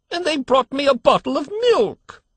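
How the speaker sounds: shrill protest. A narrator's reading. Old man in the crib protesting his milk, shrill. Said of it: "his voice rose to a shrill note of protest".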